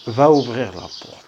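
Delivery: slow, loud reading of the command; loud model line. Shown as a demonstration. Teacher modelling a command to pupils, loud and slow. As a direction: call it out clearly for the class. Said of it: "teacher models sentence".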